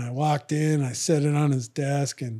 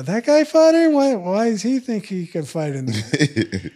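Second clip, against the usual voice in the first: incredulous; 5 semitones higher, 4 dB louder than usual. Retold or imitated. Incredulous retold reaction, high and far.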